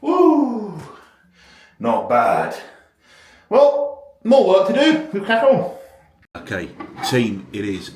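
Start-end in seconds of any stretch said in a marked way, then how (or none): 0.0-5.8 excited cheer. Real, not acted. Cheering after holding the pose.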